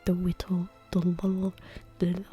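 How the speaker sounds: "whittle" with a w in place of the l, whispered; tongue-tied attempts at "the little"; imitated tongue-tie. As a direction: stumble over "whittle" and "little", trailing into a garble. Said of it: "I couldn't say".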